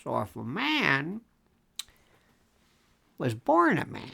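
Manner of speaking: ordinary delivery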